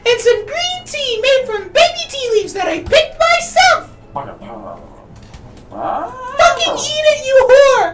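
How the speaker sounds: very high sobbing shouts; furious chef. Acted. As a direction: scream it through tears. Chef character cries and yells.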